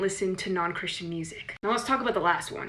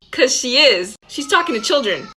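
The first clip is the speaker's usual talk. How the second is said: normal talking voice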